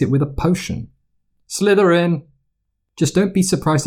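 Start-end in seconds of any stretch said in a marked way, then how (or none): 1.5-2.2 high, ringing announcement of the house; Sorting Hat call. A narrator's reading. The hat calling out the new student's house.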